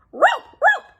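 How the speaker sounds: very high yelp; Scooby yelp. Acted. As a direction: yelp it like a frightened dog. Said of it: "Scooby yelped".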